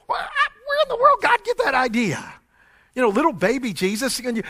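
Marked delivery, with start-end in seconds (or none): 0.1-2.3 amazed wonder. Acted. Marvelling at God's plan.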